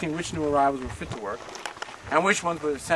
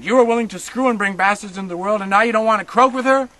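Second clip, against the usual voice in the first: loud, higher brutal yelling; furious guard; 5 semitones higher, 8 dB louder than usual. Retold or imitated. Guard yelling while slapping the woman, loud and furious. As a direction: spit it at her in rage. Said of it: "The man slapped the woman again".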